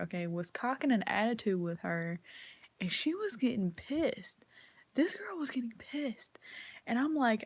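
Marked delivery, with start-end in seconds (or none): 2.8-6.4 gossipy unvoiced whisper, higher. Real, not acted. Telling viewers about the angry girl, whispered.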